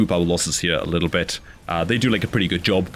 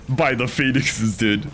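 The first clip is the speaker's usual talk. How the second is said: ordinary talk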